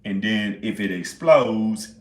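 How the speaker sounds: normal talking voice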